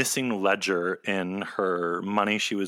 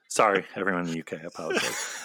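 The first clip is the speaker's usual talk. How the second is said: ordinary delivery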